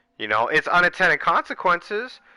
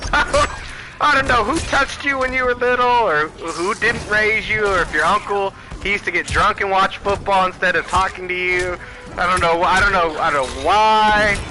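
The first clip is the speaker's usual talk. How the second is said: mocking shout; 6 semitones higher than usual. Real, not acted. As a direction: yell it out, half laughing, taunting. Mocking rant at the audience.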